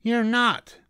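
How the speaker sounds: very high-pitched indignant retort; squeaky-high contradiction; snapping rabbit. A narrator's reading. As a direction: snap back in a high rabbit voice. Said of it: "snapped the rabbit".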